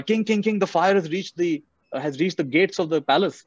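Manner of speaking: high-pitched urgent messenger; alarmed guard. Retold or imitated. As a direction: call out to the king as a running guard. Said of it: "a guard comes running".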